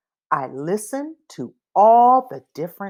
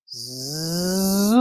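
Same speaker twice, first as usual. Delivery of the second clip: lawnmower zoom; 3 dB quieter than usual. Shown as a demonstration. Reading to a child, voicing the lawnmower's soft zoom. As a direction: hum it out like a distant mower. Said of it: "It goes like this".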